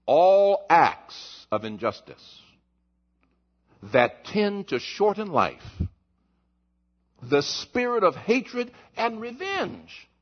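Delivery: very slow, weighty reading; slow solemn reading. Real, not acted. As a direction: read each phrase deliberately. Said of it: "I'm gonna read it".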